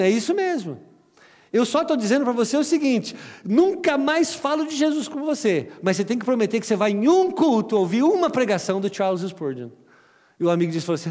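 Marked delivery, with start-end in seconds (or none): 0.0-9.7 insistent. Retold or imitated. Jovem insiste com o amigo.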